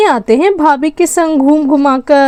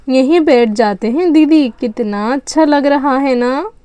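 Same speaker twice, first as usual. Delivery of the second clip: ordinary talk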